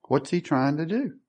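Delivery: normal talking voice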